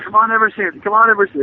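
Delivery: lightly voiced high coaching call; a hint of a corner coach yelling; mock coach call. Friends joke, imitating coach calling from the corner. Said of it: "my friends still joke around about that".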